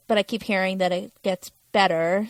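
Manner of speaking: normal talking voice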